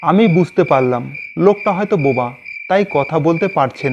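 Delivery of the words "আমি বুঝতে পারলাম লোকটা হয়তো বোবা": ordinary delivery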